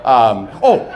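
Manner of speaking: usual speaking voice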